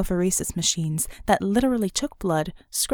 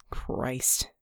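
breathy, much softer under-the-breath curse; muttered; 8 semitones lower, 7 dB quieter than usual. A narrator's reading. Wounded man cursing at his medic, muttered. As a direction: hiss it out low in pain. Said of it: "Red muttered".

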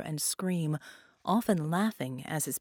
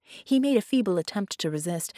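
usual speaking voice

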